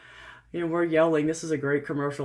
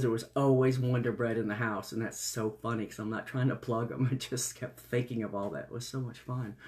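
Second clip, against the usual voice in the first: usual speaking voice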